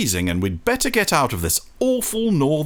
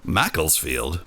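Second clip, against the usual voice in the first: ordinary delivery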